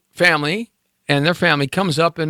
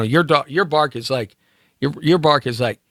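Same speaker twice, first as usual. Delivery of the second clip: ordinary delivery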